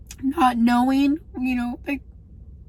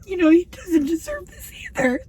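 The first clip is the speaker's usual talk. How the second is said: higher, crying protest; real tearful voice; 5 semitones higher than usual. Real, not acted. Wife protesting for her husband, tearful and higher. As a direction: talk through tears.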